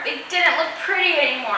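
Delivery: ordinary delivery, nothing marked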